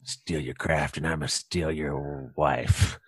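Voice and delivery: low, slow menacing threat; low villain voice. Acted. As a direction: growl out a cartoon threat.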